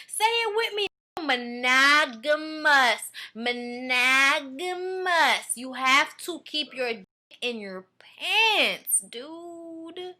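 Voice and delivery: very slow, stretched-out syllables; mock slow lesson. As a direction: spell it out slowly for a dense pupil. Spells out lesson for the dude.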